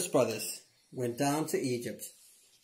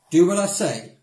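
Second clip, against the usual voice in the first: louder, higher stern command; 6 semitones higher, 9 dB louder than usual. A narrator's reading. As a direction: order them firmly. Joseph commanding brothers.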